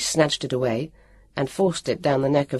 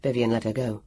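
ordinary delivery, nothing marked

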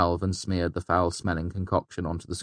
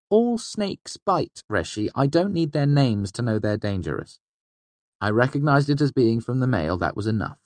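put-on higher, lively young trickster voice; young fey man voice; 7 semitones higher than usual. A narrator's reading. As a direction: voice Bast higher, quick and sly. Bast voiced by narrator.

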